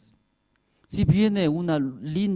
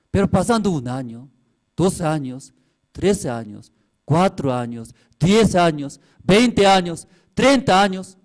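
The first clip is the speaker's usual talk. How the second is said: counting years, louder and higher toward the end; building preaching; 5 semitones higher, 7 dB louder than usual. Acted. Preacher warning the congregation, rising to a loud cry.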